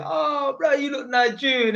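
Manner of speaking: high mock voice of people quoting; lightly voiced, high surprised "oh bro". Streamer retelling what people say to him, high mock quote. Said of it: "bare people used to say".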